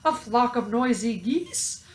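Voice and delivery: ordinary delivery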